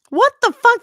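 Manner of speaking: high, loud disbelieving cry; shocked outburst. Real, not acted. Cohost hearing a podcast exists only on TikTok, loud shocked outburst. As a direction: blurt it out in real shock.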